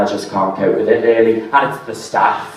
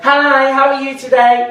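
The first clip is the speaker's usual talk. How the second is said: high, bright-on-cue cashier greeting; chirpy cashier greeting; 14 semitones higher, 3 dB louder than usual. Retold or imitated. Mocking the cashier with a high, sing-song greeting. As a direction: put on a chirpy shop-till voice. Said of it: "It's like".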